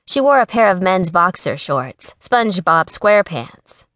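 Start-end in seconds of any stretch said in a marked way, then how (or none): none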